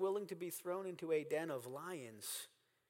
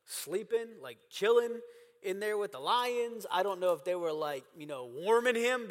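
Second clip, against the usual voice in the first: ordinary talk, nothing marked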